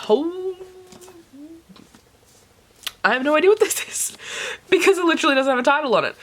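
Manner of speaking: high, excited surprise; surprised. Real, not acted. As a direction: voice jumps up in astonishment. Surprised by mystery book.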